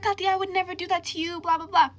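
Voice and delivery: high mock-pleading voice; mocking imitation. Best friend denying it by text, mockingly imitated. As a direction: parody a pleading denial, pitched right up.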